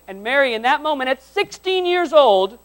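high, loud preacher building to a shout; forceful preaching. Real, not acted. Preacher telling of Mary's moment of realizing, forceful building shout. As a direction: build loudly, stretching toward the last word.

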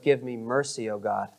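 low, soft plea to God; understated, hushed prayer; cry for mercy. Acted. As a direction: beg humbly, voice sinking. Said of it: "As we cry for mercy".

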